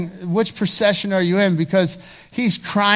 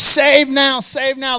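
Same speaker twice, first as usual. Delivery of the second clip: loud, raised-pitch crowd shout; crowd's cheer; 6 semitones higher, 9 dB louder, slower than usual. Acted. Preacher voices the palm-waving crowd hailing Jesus, loud cheer.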